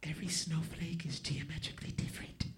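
put-on soft high girl whisper; whispered girly voice. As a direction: marvel at it in a hush. Imitating woman whispering.

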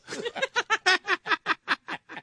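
long high-pitched cackle; real high laughing burst; laughing fit. Real, not acted. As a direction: crack up in high, helpless laughter. Host cracks up at listener message.

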